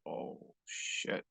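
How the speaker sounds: whispered, drawn-out curse; barely audible comic dismay; hushed dread. Retold or imitated. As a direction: whisper it as it sinks in. Retold realization of his trouble.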